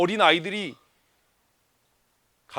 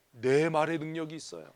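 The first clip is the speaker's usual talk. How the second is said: ordinary talk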